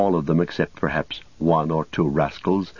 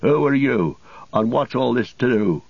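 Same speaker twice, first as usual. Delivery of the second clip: higher, challenging farmer's voice; farmer's raised-pitch challenge; farmer crying out; 5 semitones higher than usual. A narrator's reading. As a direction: demand it of a stranger, pitched up. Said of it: "he cried".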